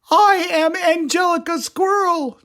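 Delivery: very high, sweet little squirrel voice; calm squirrel lady. Acted. Squirrel greets Attila at the club, very high voice. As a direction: play a tiny serene squirrel. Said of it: "she said".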